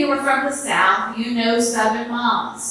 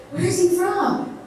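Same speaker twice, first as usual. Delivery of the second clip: a hint of a higher, eager mama; mama asking; 8 semitones higher, slower than usual. Mama on the phone asking daughter, lightly voiced.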